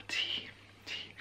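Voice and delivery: soft sigh, then breathy whisper; whispered tea sigh. Real, not acted. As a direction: sigh and whisper over the cup.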